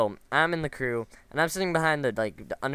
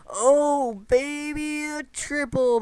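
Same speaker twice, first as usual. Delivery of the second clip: much higher, hammed-up gamer cheer in a put-on voice; excited cheer; 5 dB louder, much slower than usual. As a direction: squeal it like you just scored a triple kill. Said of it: "I would have been like".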